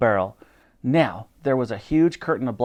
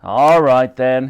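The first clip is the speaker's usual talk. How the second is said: huffed, grudging consent; huffy; 7 dB louder, slower than usual. A narrator's reading. The Admiral relents to the girl, huffy. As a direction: give in with a huff. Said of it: "he huffed".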